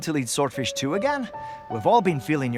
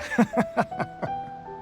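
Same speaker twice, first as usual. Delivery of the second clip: high, light trailing laugh; amused chuckle; 11 semitones higher, 4 dB quieter than usual. Acted. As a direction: chuckle softly at your own anecdote. Amused by buddy's grumbling story.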